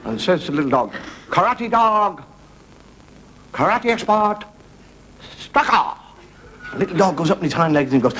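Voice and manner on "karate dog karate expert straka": higher, sing-song mock Japanese accent. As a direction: play the Japanese dog breeder with a hammed-up accent. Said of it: "there's a Japanese fellow".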